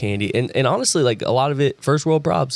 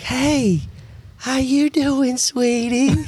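much higher, sugary greeting; high sweet voice; much slower than usual. Retold or imitated. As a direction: coo it like greeting a baby. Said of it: "they were like".

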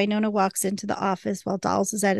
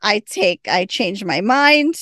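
lightly panicked backpedalling, growing louder; mock panic; 4 semitones higher, 5 dB louder, slower than usual. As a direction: playfully act out a flustered retraction. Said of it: "Waverly's panicking and she's like".

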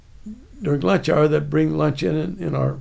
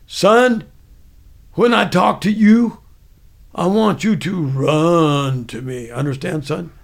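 loud Southern order, drawn out on "run"; Southern sergeant; 5 dB louder, slower than usual. Retold or imitated. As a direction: imitate a commanding Southern sergeant. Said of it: "He spoke with a Southern accent".

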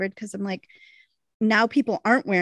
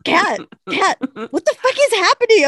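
high, louder, frantic call to her friend; mock-frantic, way up high; retold panic; 10 semitones higher, 5 dB louder than usual. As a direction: shriek for help from Kat. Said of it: "And I'm like".

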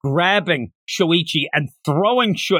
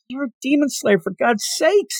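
high-pitched mock exasperation; exasperated scolding; 8 semitones higher than usual. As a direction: scold him in raised, incredulous pitch. Acted exasperated telling-off of the character.

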